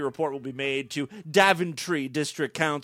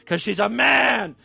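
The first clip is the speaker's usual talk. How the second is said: punchline shout; 6 semitones higher, 5 dB louder than usual. Real, not acted. Joking punchline about the athlete.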